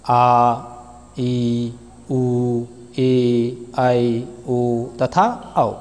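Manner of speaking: each vowel stretched long and slow, आ ई उ ए ऐ ओ औ; long vowels drawn out. Shown as a demonstration. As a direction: hold every vowel like a teacher demonstrating length. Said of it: "जिनके उच्चारण में अधिक समय लगता है".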